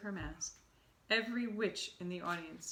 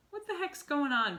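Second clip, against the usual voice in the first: put-on high, loud boy demanding answers; uneasy demand; 8 semitones higher, 7 dB louder than usual. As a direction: blurt it, annoyed and uneasy. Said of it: "Bruno asked".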